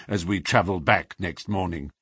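plain reading voice

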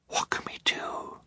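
hushed, voiceless whisper. A narrator's reading. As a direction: whisper it secretly to your siblings. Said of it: "Violet whispered to her siblings".

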